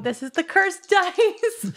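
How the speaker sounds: high, loud, drawn-out lament; dismayed outcry. Real, not acted. Player blames the cursed dice after a roll, loudly dismayed. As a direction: cry out slowly at the rotten luck.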